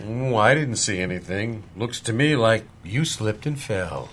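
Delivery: low, soft, casual denial; feigned ignorance. Acted. Sheriff refusing the deputy's complaint, feigned ignorance.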